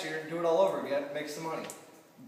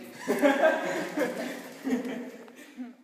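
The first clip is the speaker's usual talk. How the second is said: laugh; 10 semitones higher, 4 dB louder than usual. Real, not acted. Laughs at own pinky joke.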